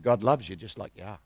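low, soft and gentle; tender reassurance. Retold or imitated. Preacher reassures the barber lady, soft and low. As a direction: lay it on her gently. Tender word to crying woman.

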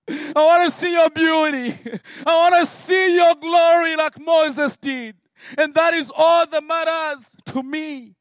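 loud, tearful, high plea; crying prayer. Retold or imitated. A praying man crying out loudly to God for his glory. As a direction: cry out your longing to God.

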